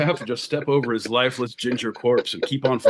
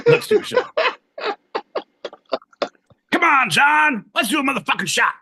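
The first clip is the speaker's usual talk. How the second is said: high loud party shout; rally everyone to drink; 5 dB louder than usual. Real, not acted. Hyping a drinking toast.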